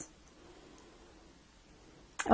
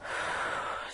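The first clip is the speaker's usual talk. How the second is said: deep sigh; 12 dB quieter than usual. Real, not acted. Heavy sigh after memory.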